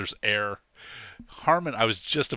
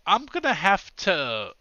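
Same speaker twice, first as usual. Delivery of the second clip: high, halting officer voice; slowly, hesitantly announce an arrest; pitched-up, apologetic policeman with long pauses; reluctant policeman; 8 semitones higher, 4 dB louder, slower than usual. Acted.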